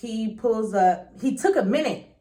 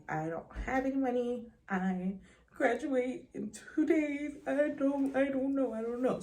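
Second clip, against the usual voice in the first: small defeated voice; 8 dB quieter than usual. Retold or imitated. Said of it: "I'm like".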